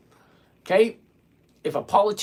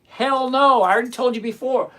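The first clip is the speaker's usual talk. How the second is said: loud, high burst, then quicker; emphatic raised voice; exasperated refusal; 5 semitones higher, 6 dB louder than usual. Real, not acted. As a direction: refuse loudly, fed up with being asked. Exasperated refusal to repeated question.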